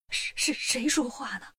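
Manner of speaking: a timid, stammering question into the dark; mildly scared voice asking who is speaking; frightened stammer. A narrator's reading. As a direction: stutter it like someone hearing a voice with no body. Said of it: "都吓坏了".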